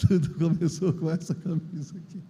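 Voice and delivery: said through a soft chuckle; laughing. Real, not acted. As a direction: laugh gently while repeating it. Shows shirt, repeats it laughing.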